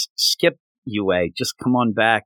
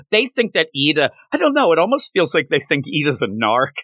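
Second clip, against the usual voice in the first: ordinary delivery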